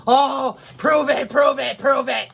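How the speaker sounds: loud sneering "prove it" chant; mock taunt. Mocking doubters who demand proof, loud taunt. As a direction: throw their challenge back loudly.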